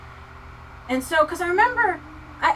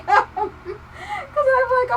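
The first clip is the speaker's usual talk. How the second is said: higher, slow, laughing through the anger; laughing while mad; 10 semitones higher, much slower than usual. Real, not acted. Telling viewers about the pie, laughing angrily. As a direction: crack up mid-rant. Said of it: "that's how mad about it I still am".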